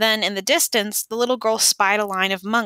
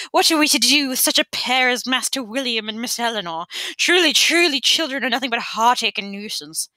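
higher, fretful mock constable voice; fussy treble imitation; 3 semitones higher than usual. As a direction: whine it like a fussing old steward. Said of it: "fussy treble of Piers Discabius".

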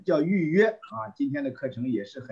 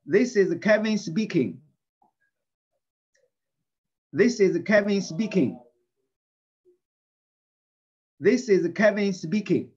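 loud clear drill voice for the class; teacher leading a repeat-after-me drill; louder model read; 5 semitones higher, 5 dB louder than usual. Shown as a demonstration. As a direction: say it clearly so they can repeat. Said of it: "Read after me, please, three times".

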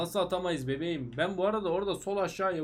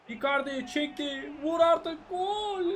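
excited shout; 9 semitones higher, slower than usual. Real, not acted. Striker shoots in the game.

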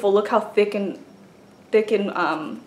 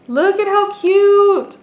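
high, slow "look at how cute"; showing off the pocket letter her friend made, high and gushing; gushing delight; 10 semitones higher, 4 dB louder, slower than usual. Real, not acted. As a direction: stretch it out with delight.

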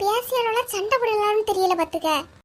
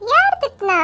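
high, louder question; sharp high who-scolded-you; surprised asking; 9 semitones higher, 4 dB louder than usual. Acted. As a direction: ask who did it, alarmed.

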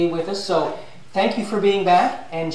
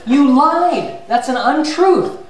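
higher, louder, slow scolding; a mock-furious Lord rebuking her; hammed-up divine anger; 7 semitones higher, 3 dB louder, slower than usual. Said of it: "The Lord was furious".

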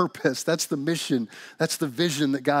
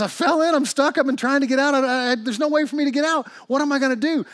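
high, loud, panicky explaining; frantic; 12 semitones higher, 6 dB louder than usual. Acted. Trapped man explains to his buddy, frantic. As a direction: plead helplessly, words tumbling out. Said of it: "and he's getting frantic".

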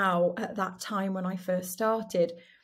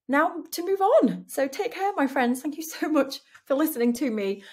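bright farewell; 6 semitones higher, 5 dB louder than usual. Real, not acted. Farewell to listeners.

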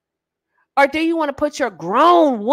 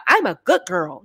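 higher-pitched goody-girl imitation; mock good girl; 4 semitones higher than usual. Woman announcing herself to a man, mock-innocent. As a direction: mimic her in a higher voice. Mimics women declaring themselves good girls.

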